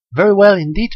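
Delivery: high, loud eager answer; glad reply. A narrator's reading. Daughter answering her mother about life underwater: eager. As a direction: proudly assure her mother.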